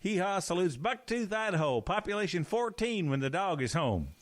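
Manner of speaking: mock cheery show salute; imitating a folksy country TV host; announcer salute. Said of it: "they would go".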